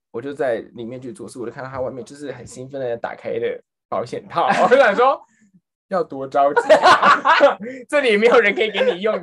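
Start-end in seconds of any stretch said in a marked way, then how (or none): 5.9-9.2 loud, high exclamation through laughter; amused disbelief. Retold or imitated. Clerk watching customer open the box outside, laughing disbelief. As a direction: exclaim it incredulously while laughing.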